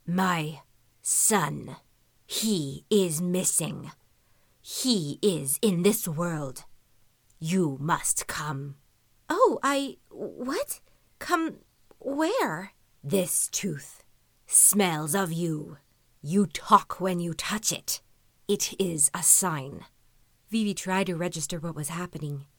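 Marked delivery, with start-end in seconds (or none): 0.1-8.8 slow, low growling creature; slow beast growl. A narrator's reading. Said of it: "The beast began to growl". 9.3-12.7 high, flustered questions; startled. A narrator's reading. Girl startled by talking beast. 13.0-19.8 slow whispering creature; beast voice. A narrator's reading. Beast explains the tooth.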